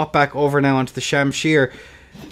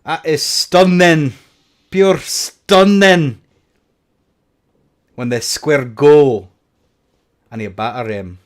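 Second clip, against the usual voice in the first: put-on slow, hammy Scottish accent; bad Scottish accent; 5 dB louder, slower than usual. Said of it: "That's a really bad Scottish".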